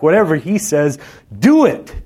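high, loud climbing command peaking on do it; exclamation. Shown as a demonstration. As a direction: deliver it like an exclamation mark, rising to a cry. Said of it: "There should be an exclamation mark".

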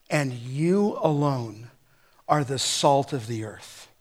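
YOU slow and low, each word weighed; emphatic you. Shown as a demonstration. Preacher stressing you alone to the church. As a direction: lean hard on you and you alone. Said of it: "we call that in the Greek language emphatic".

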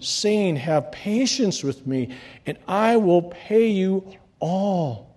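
lifted pulpit reading voice; scripture reading. Acted. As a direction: proclaim the parable to the church. Reading scripture aloud to congregation.

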